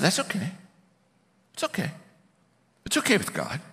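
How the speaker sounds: soft, high-pitched comforting voice; gentle reassurance. Retold or imitated. Coach comforting his agnostic colleague, gently reassuring. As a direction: soothe him lightly, pitched high.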